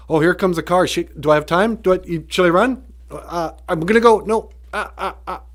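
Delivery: high, loud, dithering squirrel voice; frantic squirrel. Acted. Squirrel at the roadside as a car comes, mock frantic. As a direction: play a squirrel in a comic panic of indecision.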